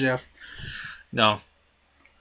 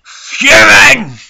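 furious shout; 14 semitones higher, 14 dB louder than usual. Acted. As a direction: howl it out, much higher and louder. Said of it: "and I'm fucking".

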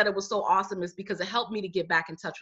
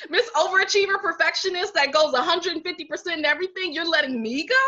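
higher, laughing through it; laughing self-mockery; 9 semitones higher, 3 dB louder than usual. Real, not acted. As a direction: laugh at your own indignation. Mocking herself about being fired.

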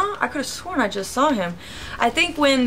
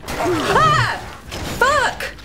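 startled yell; 13 semitones higher than usual. Real, not acted. Sudden startle in-game.

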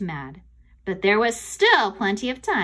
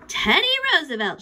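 loud, higher shout; raised, confident shout; 8 semitones higher, 5 dB louder than usual. A narrator's reading. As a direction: call out the answer. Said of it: "shouted Morgan Brocklebank".

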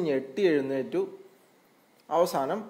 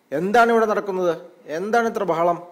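bear demanding; 5 semitones higher, 7 dB louder than usual. A narrator's reading. Bear arrives and demands what the noise is, loudly. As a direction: call the squabblers to order. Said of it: "കരടി ചോദിച്ചു".

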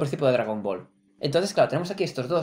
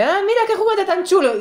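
put-on much higher, loud, delighted kid voice; childish Luffy; 12 semitones higher, 4 dB louder than usual. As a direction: squeal like a kid with a toy. Voicing Luffy finding a toy.

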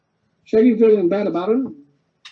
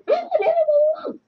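high, whiny cartoon-ghost oh-oh wail; mock pained cries, much higher. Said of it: "Shrieko's going".

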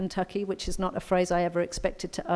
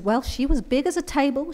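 put-on American accent; 7 semitones higher than usual. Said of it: "excuse my American accent".

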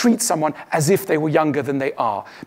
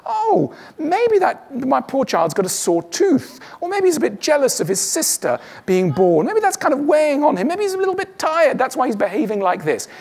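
understanding parent; 8 semitones higher than usual. Pretend. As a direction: coax it out kindly, like soothing a fussy child. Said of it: "You go".

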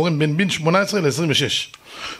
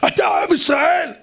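high, slow ranting yell; shouting neighbour; 8 semitones higher than usual. Retold or imitated. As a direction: stretch out the outraged accusation. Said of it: "אבל למה אתה צועק". (צעקה גבוהה ומרוחה של שכן)

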